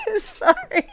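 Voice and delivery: high, laughing apology. Real, not acted. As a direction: still giggling as he apologises. Still laughing at own joke.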